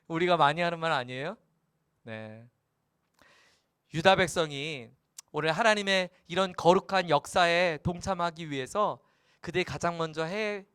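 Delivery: ordinary talk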